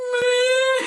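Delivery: loud, very high hammed-up wail; mock crying. Pregnant woman learns Chick-fil-A is closed, mock wailing. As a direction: wail it like a thwarted child, exaggerated. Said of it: "I cried".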